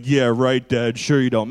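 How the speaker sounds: loud, low mocking kid voice; mock sarcastic kid retort. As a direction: scoff it back like a doubting teen. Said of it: "my kids think I'm being sarcastic".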